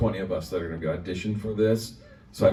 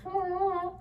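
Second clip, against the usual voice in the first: mock gasp; 7 dB quieter than usual. Mocking agent's advice.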